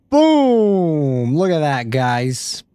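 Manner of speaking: high, loud boom; triumphant boom. Real, not acted. Streamer reveals finished Batman to viewers, triumphant. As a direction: slam it down like a reveal.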